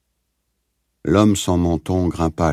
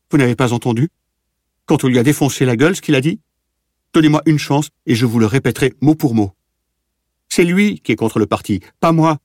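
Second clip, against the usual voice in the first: higher, louder, faster, near screaming; frantic accusation; 8 semitones higher, 4 dB louder, faster than usual. A narrator's reading. Prisoner denounces another man to the officer, frantic shriek. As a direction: scramble to sell out the other man. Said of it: "cria-t-il".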